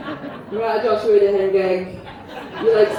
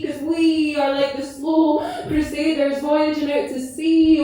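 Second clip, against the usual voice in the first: measured, lilting poem recital, leaning on each line; spoken word poem; 3 semitones higher than usual. Acted. Performer recites her poem about Gran to audience, tender recital. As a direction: let the verses breathe like a love letter to Gran.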